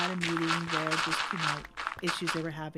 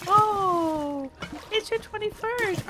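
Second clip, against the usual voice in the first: chirpy waiter; 13 semitones higher, 5 dB louder, much slower than usual. Retold or imitated. Waiter voiced in story.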